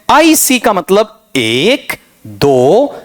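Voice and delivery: put-on slowly counting syllables like a confused child; slow mock counting. Teacher imitates a student counting syllables, slow. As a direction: count it out slowly, mocking the method. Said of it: "ऐसे ढूंढेगा बच्चा".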